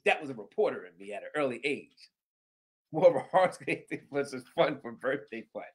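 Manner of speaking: normal talking voice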